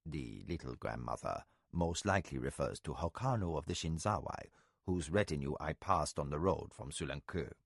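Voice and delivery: low, soft, gentle explanation; smooth things over gently; quiet low calm voice. A narrator's reading. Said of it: "very gently".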